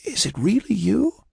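high, frail, wondering question; disbelief. Acted. Patient doubts her lost friend is there, amazed high voice. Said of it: "she gasped in disbelief".

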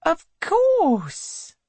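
high, light reply to her husband; the wife's dutiful answer. A narrator's reading.

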